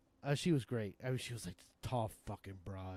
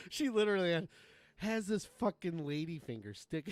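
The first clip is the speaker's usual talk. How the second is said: reenacting; 6 semitones higher, 4 dB louder than usual. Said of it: "I'm gonna push my mic away and I'm gonna reenact it".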